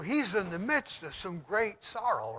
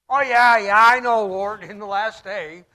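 loud, higher brush-off "oh yeah yeah, I know"; mock dismissive; 4 semitones higher, 16 dB louder than usual. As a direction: wave him off like you already know it all. Preacher mocks Martha's dismissive answer.

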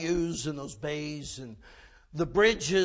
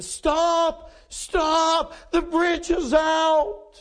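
high, drawn-out desperate yell; shouted warning; 9 semitones higher, 5 dB louder, much slower than usual. Retold or imitated. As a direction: call out to oncoming cars through the mist. Waving down cars to warn them.

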